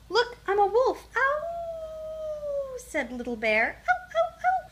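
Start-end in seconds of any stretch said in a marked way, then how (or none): 0.1-1.1 high, loud little cub voice; excited boast. A narrator's reading. Little Bear pretends to be a wolf, high excited boast. 1.2-2.8 long, very high wolf howl. A narrator's reading. Little Bear plays at being a wolf, high howl. 3.9-4.7 short, very high little yelps; babies' shrieked howls. A narrator's reading. Baby bears copy their brother's howl, high shrieks. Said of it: "shrieked the babies".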